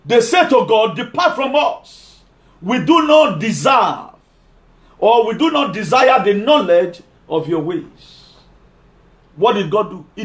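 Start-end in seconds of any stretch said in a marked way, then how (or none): 1.0-8.4 drawn out, high, each word weighed; slow emphatic reading. Acted. Preacher to congregation, slowly and pointedly. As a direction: read the verse slowly and pointedly.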